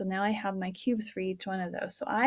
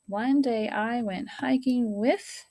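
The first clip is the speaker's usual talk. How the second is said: slow reread; 2 semitones higher, slower than usual. Shown as a demonstration. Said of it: "rereading the sentence aloud".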